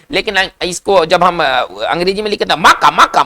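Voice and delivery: normal talking voice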